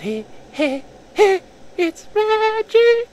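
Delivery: very high, wailing death cry; hammed-up high dying wail; dying girl voice. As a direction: squeal it out as she dies. Character dying in retelling.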